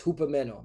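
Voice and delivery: "hupameno" as hoo-pa-MEN-o; corrected Greek pronunciation. Shown as a demonstration. Preacher correcting the expected hypo, Greek pronunciation. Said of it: "That's how Esau pronounced it".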